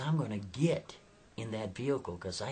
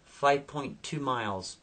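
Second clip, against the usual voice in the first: ordinary delivery, nothing marked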